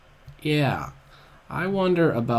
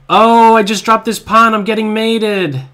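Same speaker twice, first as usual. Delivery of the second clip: sudden loud yelp of dismay, higher; alarmed outburst; 7 semitones higher, 11 dB louder than usual. Real, not acted. Player reacting to a blunder, loud dismayed yelp. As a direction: blurt it out as the pawn drops. Dropping a pawn in blitz.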